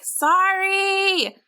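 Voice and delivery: high, loud sorry tossed to the crowd; quick apology called out. A narrator's reading. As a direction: call it out to everyone at once. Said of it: "He called a quick".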